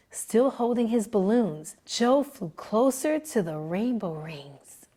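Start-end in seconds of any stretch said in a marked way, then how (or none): none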